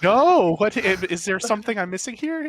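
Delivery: loud, high mock alarm; baffled reply. Husband replaying his answer to father-in-law, baffled. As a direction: burst out high on the first word.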